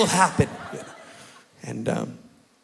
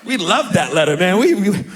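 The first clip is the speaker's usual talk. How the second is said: normal talking voice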